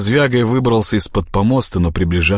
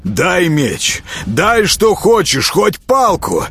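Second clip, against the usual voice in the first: high, insistent calling out; urgent demand; 10 semitones higher, slower than usual. Acted. Bound prince demanding any weapon, urgent raised voice. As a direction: demand it, climbing higher.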